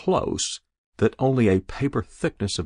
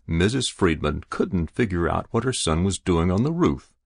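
ordinary delivery

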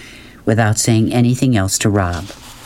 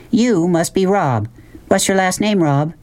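put-on high-pitched mother addressing a boy; lightly voiced, high mother; inquisitive mother; 9 semitones higher than usual. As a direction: size up a newcomer in a high voice.

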